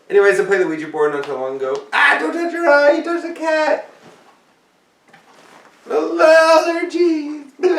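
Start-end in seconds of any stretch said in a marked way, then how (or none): 1.9-7.8 very high panicky squeal. Pretend.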